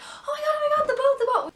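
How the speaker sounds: high, loud panicked yelling; panicked shout. Retold or imitated. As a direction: scream as the boat flies off. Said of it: "everyone was freaking out".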